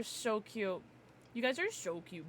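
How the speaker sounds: ordinary talk, nothing marked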